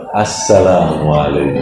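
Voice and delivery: low, soft salam said with a smile; smiling salam. Acted. Woman answers a rude shopper at the market with a smile. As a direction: smile as you greet. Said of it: "Sambil senyum".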